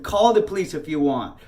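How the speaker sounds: loud, defiant retold challenge; mad. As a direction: throw down an angry dare. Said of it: "I was so mad".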